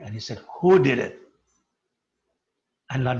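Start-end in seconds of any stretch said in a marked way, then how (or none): 0.6-1.2 sharp, loud demand of who broke it; angry demand. A narrator's reading. Jagadanand finding the broken danda, loud angry demand. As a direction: bark the question out. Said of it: "he was very angry".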